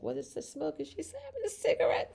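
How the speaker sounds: high squeaky viewer, lightly voiced; puzzled viewer voice. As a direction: play a baffled newcomer, high pitched. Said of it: "you're like".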